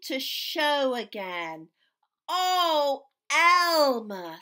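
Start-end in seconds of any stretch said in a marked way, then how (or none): 2.3-4.4 fond exclamation. A narrator's reading. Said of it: "said the other elephants".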